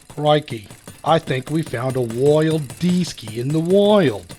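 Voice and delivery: hammed-up Australian wildlife presenter, slow and hushed; a Crocodile Hunter-style Australian accent, spotting a rare beast; mock Australian accent. Spotting a player like a wildlife host, mock Australian accent. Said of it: "I don't know what happened to my accent".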